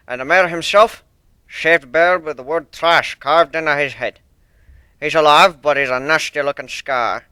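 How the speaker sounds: put-on loud, stern old sailor with rolled R's; stern sea dog accent. One-winged Pegasus apologising to Fluttershy, stern thick accent.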